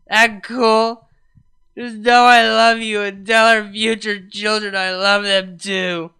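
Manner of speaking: put-on loud, sloppy self-pitying girlfriend; drunk weepy rant. Vinyl on voicemail berating herself, loud drunk weeping. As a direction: blubber it loudly like a wasted apology.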